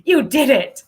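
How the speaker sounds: mock triumphant inner voice; self-congratulation. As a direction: cheer yourself on, half joking. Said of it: "looking back thinking".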